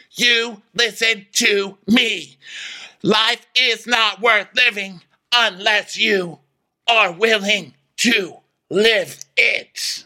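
high, very slow, word-by-word lecture; Heather Dubrow. Acted. Lecturing the women on living, slow high declaiming. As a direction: pronounce every word slowly and grandly. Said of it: "And Heather Dubrow's like".

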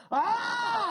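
sudden high yelp of fright, far off his own voice; retold scream. Telling the panel how he screamed at the crash, high startled yelp. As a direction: yelp the way you did when the truck hit. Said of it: "siento la pinche camioneta y le hago".

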